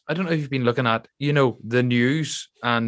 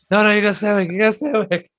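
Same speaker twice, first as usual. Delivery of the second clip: high laughing film quote; 9 semitones higher, 4 dB louder, faster than usual. Retold or imitated. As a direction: laugh through the line. Quoting the film scene, laughing.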